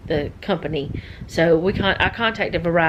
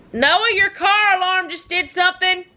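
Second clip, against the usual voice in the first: raised, much higher call; 12 semitones higher, 4 dB louder than usual. Real, not acted. Telling Noah about the car alarm, calling out. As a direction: call across the house to someone. Calls to Noah in another room.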